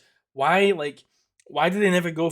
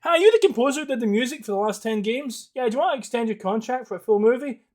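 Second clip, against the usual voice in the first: boss on the phone; 9 semitones higher, 7 dB louder than usual. Pretend. Imagined boss call.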